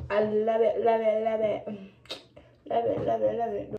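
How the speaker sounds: loud repeated love it; excited chant of love it; gushing delight. Real, not acted. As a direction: gush over the product.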